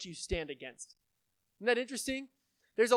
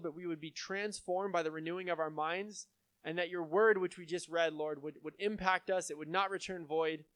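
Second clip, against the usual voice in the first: earnest, slightly lowered praying voice; prayer; 3 semitones lower, 4 dB quieter than usual. Real, not acted. Pastor closing a prayer over the congregation, earnest and subdued. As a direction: pray aloud on behalf of the congregation.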